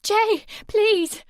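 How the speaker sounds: high, loud begging; desperate plea. A narrator's reading. Sammy begs Jay to stop. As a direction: beg him through tears. Girl begging, just started crying.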